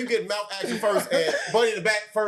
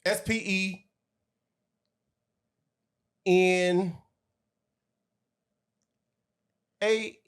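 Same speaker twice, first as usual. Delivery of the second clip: slow spelling out; 2 semitones higher, much slower than usual. Shown as a demonstration. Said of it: "You wanna try again".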